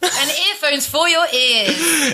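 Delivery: slow mock ad read. Mock ad tagline.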